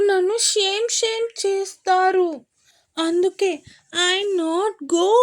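put-on child begging his dad to stay; whiny little boy. A narrator's reading. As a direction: whine it like a shy boy about to cry. Child refuses to play, near tears.

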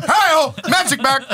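high, loud mock celebration; revived elf. Elf waking up with magic restored, gleeful cheer. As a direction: pop up with a triumphant cheer.

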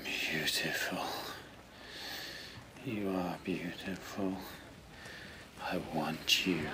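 slow creepy whisper. Acted. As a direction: breathe it close and low.

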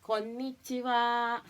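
Konnichiwa spelled out slowly, each syllable clear, double n held; teacher modelling Konnichiwa. Shown as a demonstration. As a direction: say Konnichiwa slowly for students to copy. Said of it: "Konnichiwa is good afternoon".

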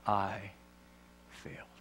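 whispered, fading almost to nothing; broken whisper. Retold or imitated. Peter admitting his failure to himself, whispered. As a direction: breathe out the admission in a defeated whisper. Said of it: "Peter in his heart said".

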